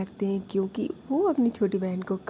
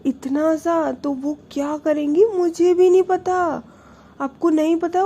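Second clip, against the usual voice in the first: high, loud, upset pleading; upset outburst; 8 semitones higher, 6 dB louder than usual. Retold or imitated. Prachi loudly tells her sister how scared she is. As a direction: pour out panic about her daughter.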